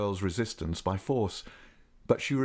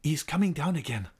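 higher-pitched urgent warning; high, urgent woman's cry; sudden exclamation; 6 semitones higher than usual. A narrator's reading. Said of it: "Suddenly she exclaimed".

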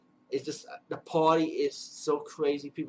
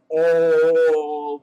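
a loud, startled "Oh"; shocked oh; 2 semitones higher, 12 dB louder than usual. Retold or imitated. Hearing the dad call his son a loser, a loud shocked oh. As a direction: burst out in sudden shock. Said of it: "I was like".

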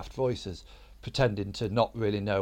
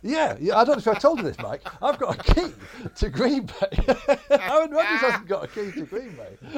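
gleeful boast; 11 semitones higher, 6 dB louder than usual. Real, not acted. Boasting about the key, laughing.

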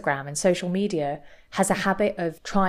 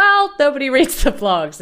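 mock dismissive; 7 semitones higher, 7 dB louder than usual. Imitating Bethany dismissive.

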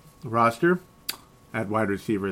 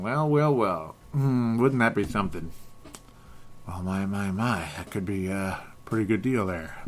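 put-on lightly done old detective voice; a hint of a softer old detective; Joe Kenda voice; 3 semitones lower, 6 dB quieter than usual. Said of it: "I was imitating an old de-, detective there".

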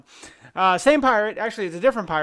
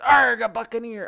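pirate voice; 6 semitones higher, 3 dB louder than usual. Retold or imitated. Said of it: "you can do the same joke".

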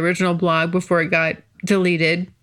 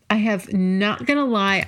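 usual speaking voice

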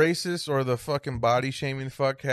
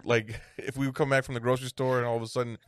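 ordinary talk, nothing marked

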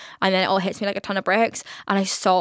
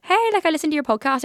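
higher, quicker imitated listener voice; eager fan; 7 semitones higher, faster than usual. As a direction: pipe up quickly like a fan approaching. Imitates a fan approaching her.

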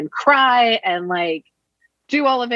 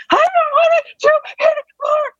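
very high and louder, slow, a hammed-up complaining boy; whiny kid refusal; 4 dB louder than usual. Mother imitating her son refusing to wipe the counters, high loud whine. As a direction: drag it out like a kid begging to stop.

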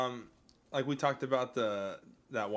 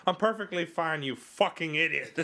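higher exasperated quote tipping into laughter; mock irritation; 6 semitones higher, 4 dB louder than usual. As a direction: retort crossly, amused underneath. Re-quoting Mason's irritated reply.

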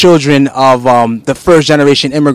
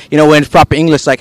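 normal talking voice

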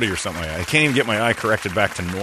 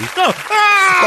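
mock pained yelp; 6 dB louder than usual. Retelling being blinded while driving.

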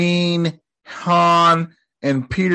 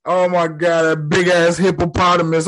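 usual speaking voice

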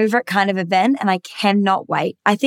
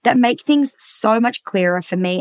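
ordinary talk

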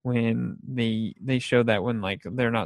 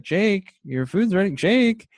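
high sing-song call across the house; 7 semitones higher than usual. Retold or imitated. Grandmother calls Jake to come eat spaghetti, high calling voice. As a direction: call the kid to dinner, pitched way up.

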